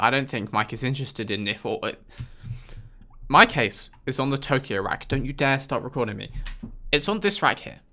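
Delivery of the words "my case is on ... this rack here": usual speaking voice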